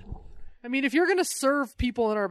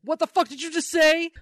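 louder, higher indignant snap; hammed-up villain outrage at the request; mock outrage; 7 semitones higher, 5 dB louder than usual. Darkseid, voiced by a comic fan, rounding on her in outrage.

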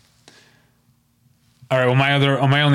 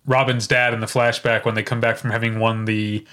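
ordinary delivery

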